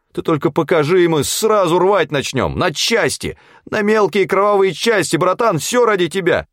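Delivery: very high, eager-to-please crony voice; alarmed protest. A narrator's reading. Фёдор swearing loyalty to the suspicious hunter, frantic. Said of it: "шарахнулся назад Фёдор".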